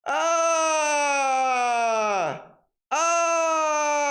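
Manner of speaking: overacted horror-stream freakout; hammed-up panic screams. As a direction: scream like the horror game got you. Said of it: "Did you hear the, the, the intensity in my voice?".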